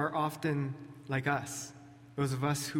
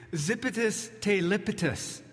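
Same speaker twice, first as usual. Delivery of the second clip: mock-solemn fake Greek, higher; 5 semitones higher than usual. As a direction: pretend it's ancient Greek, playfully. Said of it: "I'm kidding".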